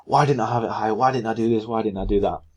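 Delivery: frustrated trader. Retold or imitated. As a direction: play the annoyed trader kicking himself, a bit hammed-up. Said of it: "people see that and be like".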